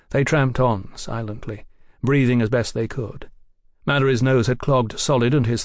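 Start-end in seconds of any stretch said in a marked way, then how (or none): none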